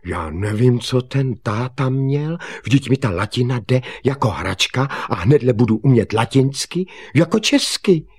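high, loud, delighted self-praise; elated bragging; joyful boasting. Acted. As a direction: crow to yourself with glee, building to the end. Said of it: "poskočil radostí a pravil".

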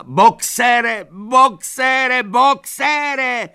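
very high, loud repeated cries; desperate screaming. A narrator's reading. Mare chases cart carrying Boxer, desperate screaming. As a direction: scream the name after someone being taken. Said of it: "křičela".

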